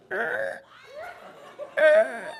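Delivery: very high, squeaky creak noise; two separated high creaks; imitated creak. Storyteller imitating the tree about to fall, high creaks. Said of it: "then I heard this".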